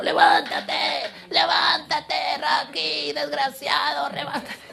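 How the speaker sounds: high strained mock yelling; hammed-up old man hollering at his fighter; imitated gruff old coach. Old trainer cursing Rocky to get up, high strained mock yell. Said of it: "Y ese abuelo".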